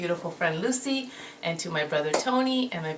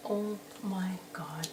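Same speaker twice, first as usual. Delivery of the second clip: slow voiceless whisper, much softer; awed whisper; 9 dB quieter, slower than usual. Real, not acted. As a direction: breathe it out in awe. Awed whisper at open palette.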